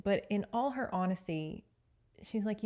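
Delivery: ordinary delivery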